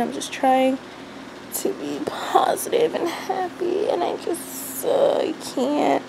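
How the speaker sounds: soft, breaking, near tears; holding back tears in a whisper; emotional whisper. Real, not acted. Vlogging mom admits she feels overwhelmed, whispering near tears. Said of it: "I don't know why I'm so emotional".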